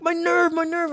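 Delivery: put-on girlfriend in pain. Girlfriend explains her sciatica pain, high mock whimper. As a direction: plead about the nerve in a high voice. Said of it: "She's like".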